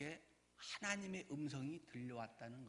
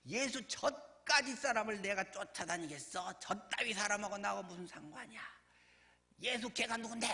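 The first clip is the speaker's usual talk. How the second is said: acted scornful outburst, higher-pitched; cursing denial; 5 semitones higher than usual. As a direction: disown him with contempt, as if cursing. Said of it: "막 이러면서 이제 저주하면서까지, 욕하면서까지". (저주하고 욕하듯 부인하는 흉내)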